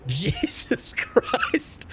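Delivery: very high, drawn-out laughing exclamation; helpless laughing. Real, not acted. Streamer cracking up at Baltimore or Detroit, laughing hard. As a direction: squeal it slowly through laughter. Laughing at own imagined scenario.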